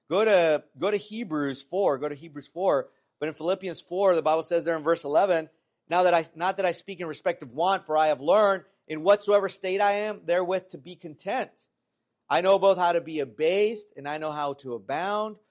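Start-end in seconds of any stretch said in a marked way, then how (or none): 5.9-15.4 emphatic scripture reading with swelling key words. Acted. Preacher reads Philippians to congregation, emphatic scripture reading. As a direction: read the verse out firmly to a congregation.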